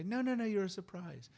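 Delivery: a hint of parents soothing their youngest; imitating parents. Parents reassuring retold.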